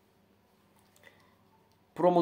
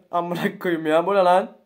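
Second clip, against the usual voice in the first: ordinary talk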